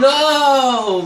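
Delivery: loud high cry of dismay; shout. Real, not acted. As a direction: yell no as it blows up. Plane destroyed, shouting.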